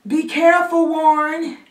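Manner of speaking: high, loud worried cry; anxious, high-pitched holler; mother calling out. Acted. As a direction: call a sustained warning after him. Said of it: "my mother calls out".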